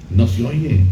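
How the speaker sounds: ordinary talk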